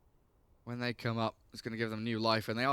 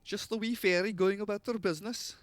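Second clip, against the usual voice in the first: put-on Scottish accent; 8 semitones higher than usual. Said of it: "chat line from Dark Willow".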